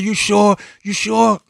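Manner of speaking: put-on high, eager girl asking twice; the girl asking if she'll get her money back, high and eager; hopeful girl. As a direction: pitch it up like a hopeful young girl. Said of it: "She said".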